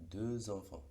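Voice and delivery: "Deux enfants" with the X linked as a Z sound; X as liaison. Shown as a demonstration. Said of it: "tiene el mismo sonido". ("Deux enfants" con la X sonando como Z)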